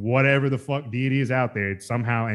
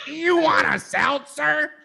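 high, slow, wide-eyed question; imitating Tommy's high, drawn-out offer; slower than usual. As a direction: stretch it out high like a dazed friend. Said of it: "just like".

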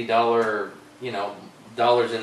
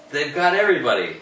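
worked up, louder and higher; real anger; 5 semitones higher, 6 dB louder than usual. Real, not acted. Host ranting about big donors owning both parties, angry. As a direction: burst out in angry frustration. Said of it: "I'm getting really angry".

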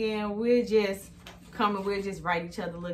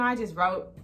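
ordinary talk, nothing marked

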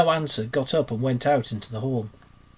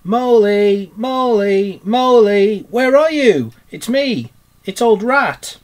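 loud, high and cheery, calling across the wood; cheerful calling; 8 semitones higher, 10 dB louder, slower than usual. Acted. Rat calls through the Wild Wood for Mole, cheerful and loud. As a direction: hail a lost friend with bright shouts. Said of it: "calling out cheerfully".

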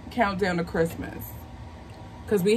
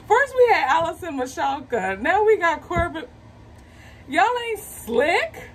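thrilled; 8 semitones higher than usual. Real, not acted. Thrilled fan teasing Hallmark.

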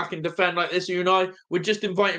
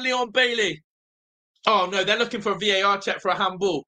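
ordinary talk

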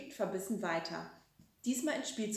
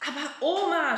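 louder, laughing protest; shouting with a grin; 7 semitones higher, 7 dB louder than usual. A narrator's reading. Frieder shouts at grandma over the tulip bed, grinning. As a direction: cry it out, amused. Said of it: "schreit der Frieder".

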